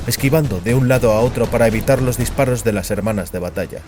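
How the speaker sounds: ordinary talk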